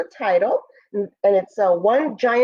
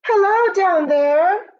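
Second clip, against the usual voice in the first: higher, louder, slow call from far above; 10 semitones higher, 4 dB louder, slower than usual. Acted. The moon calling down to the dinosaurs, louder and higher. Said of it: "she called to the lumbering dinosaurs".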